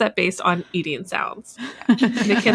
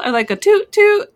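high, louder toot-toot like a toy train; toot-toot train noise; 11 semitones higher, 5 dB louder than usual. Shown as a demonstration. Host showing her favorite train noise, high toot-toot. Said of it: "instead of going choo-choo".

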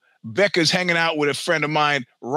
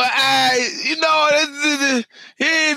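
flustered high stammer; 9 semitones higher, slower than usual. Real, not acted. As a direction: caught off guard, tercekat panik. Flustered denial to Becca.

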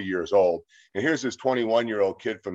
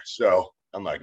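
ordinary talk, nothing marked

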